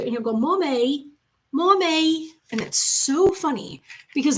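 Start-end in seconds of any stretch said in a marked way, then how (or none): 0.4-2.3 put-on toddler saying mommy in a British accent; mock British toddler calling mommy. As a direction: imitate a little British lilt on mommy, pitched up. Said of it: "the British accent".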